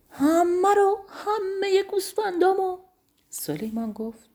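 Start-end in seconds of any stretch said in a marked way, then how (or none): 0.1-2.8 very high, loud disbelieving cry; shocked shout. A narrator's reading. Master Rajab Khan shouting on hearing all his sheep are gone, very high loud. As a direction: scream it in disbelief. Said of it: "فریاد زد".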